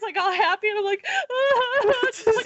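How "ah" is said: mock crying wail. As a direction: let out a high pitiful cry. Walking in hurt, crying.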